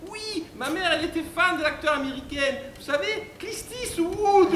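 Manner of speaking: put-on high, sing-song girlish falsetto; ditzy bimbo. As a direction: answer in a silly high bimbo voice. Acting the bimbo answering him. (voix aiguë de bimbo)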